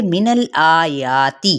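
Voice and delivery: मिनल आयाती said slowly, नून joined to लाम without wobble; tajweed demo. Shown as a demonstration. Teacher models मिनल आयाती slowly for students. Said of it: "नून की ज़बर को लाम के साथ मिलाएंगे".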